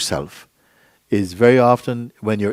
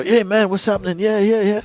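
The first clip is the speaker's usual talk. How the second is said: high-pitched chummy greeting; mock hearty buddy act; put-on mateyness; 11 semitones higher, 4 dB louder than usual. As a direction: play at being cool with friends. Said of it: "you're in a performance".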